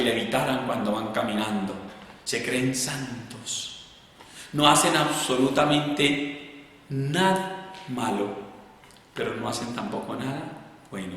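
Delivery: quietly mock the self-made saints; a soft sneer at the falsely holy; dry mock aside. Real, not acted. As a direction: drop to a soft, scornful aside. Mocking the falsely pious.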